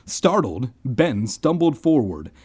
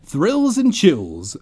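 dry mutter; 7 semitones higher, 4 dB louder, much slower than usual. Acted. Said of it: "Ben muttered".

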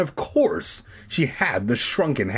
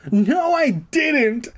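high, slow, angry yell; angry shout; 4 dB louder, much slower than usual. A narrator's reading. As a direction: shout it back in protest. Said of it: "I shouted angrily".

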